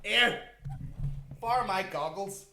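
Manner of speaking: high, playful mock offer ending in a laugh. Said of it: "just be like".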